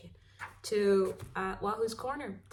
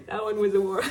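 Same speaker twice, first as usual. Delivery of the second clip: breaking up into laughter, louder; real laughing; 3 semitones higher, 6 dB louder than usual. Real, not acted. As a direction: laugh through the words. Cracks up at own warm-up reading.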